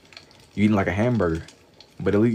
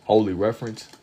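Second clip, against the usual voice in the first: ordinary delivery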